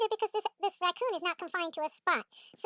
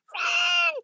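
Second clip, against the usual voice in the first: high, loud drawn-out shout; maniac yell; 11 semitones higher, 6 dB louder than usual. Retold or imitated. Yelling at the dogs to flee, maniac shout. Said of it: "I'm yelling like a maniac".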